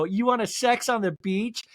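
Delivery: flirty offer. Retold or imitated. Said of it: "walk up to somebody and go".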